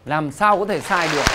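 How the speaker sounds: ordinary talk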